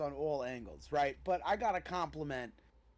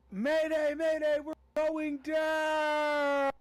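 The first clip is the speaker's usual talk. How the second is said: high, loud distress call shout; mayday radio call; 12 semitones higher, 6 dB louder, much slower than usual. Pretend. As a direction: yell it like a pilot going down. Jokes on the player name Mayday.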